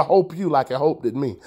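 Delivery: "hope" and "hoped" said for help and helped; auntie's dialect "hope". Retold or imitated. Playfully talking like auntie, "hope" in place of help. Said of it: "My auntie say hope".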